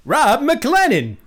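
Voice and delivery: announcer. Acted. Dramatic patron name roll call.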